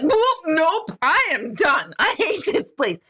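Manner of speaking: high, emphatic mock freak-out; panicked refusal. Medium reopens the creepy door, retold panicked refusal. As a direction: act out recoiling and quitting on the spot.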